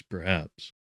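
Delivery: ordinary delivery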